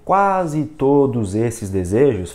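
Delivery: slow, drawn-out reading; word-by-word reading. Shown as a demonstration. Teacher shows the habit to drop, reading word by word. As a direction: stretch each word as you read. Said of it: "em vez de você começar a ler".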